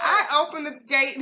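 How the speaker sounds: words squeezed out through high laughter; trying to talk while cracking up; laughing hard mid-story. Real, not acted.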